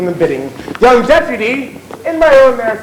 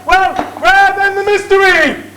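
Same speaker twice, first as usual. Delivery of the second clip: theatrical question; 9 semitones higher than usual. Acted. Showman's rhetorical question.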